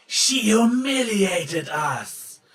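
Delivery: high, loud, slow wailing accusation; accusing chorus. A narrator's reading. Twisted friends accusing Trixie, loud slow wail. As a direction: cry out together in grievance. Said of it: "they cried at once".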